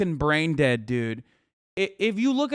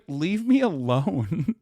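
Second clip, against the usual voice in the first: retold plea; 3 semitones lower, 3 dB quieter, slower than usual. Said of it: "She's like".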